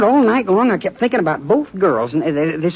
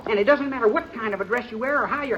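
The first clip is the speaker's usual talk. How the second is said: tender plea; 7 semitones higher, 6 dB quieter than usual. Acted. Monty pleads tenderly with Cindy.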